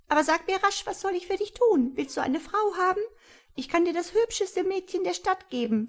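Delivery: high, chirping little creature; friendly creature. A narrator's reading. Tiny creature reassuring frightened Tom, high and chirpy. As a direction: reassure him in a tiny birdlike voice.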